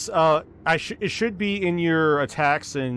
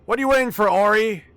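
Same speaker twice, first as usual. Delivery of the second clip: raised, higher taunting call; yelling out; 6 semitones higher than usual. Acted. Malum goading Ori into the action, yelled out. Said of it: "Malum will yell out".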